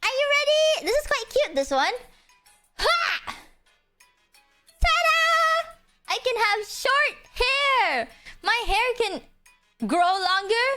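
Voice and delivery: high bright reveal; excited. Real, not acted. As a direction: squeal the ta-da. Excited reveal of hair toggle.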